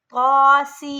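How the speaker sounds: तौसीन chanted loud, सीन hissed sharply and held long; recited with a whistling S. Shown as a demonstration. Teacher demonstrating the whistling letter. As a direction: hiss the स in तौसीन and draw it out. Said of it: "सीटी की आवाज़ में पढ़ेंगे सीन को".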